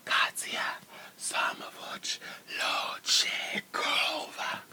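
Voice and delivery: whispered mock Russian accent; mock Russian name. Whispering a mock Russian name to the viewers. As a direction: breathe out a fake Russian name. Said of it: "I wish I could do, like, a little Russian accent".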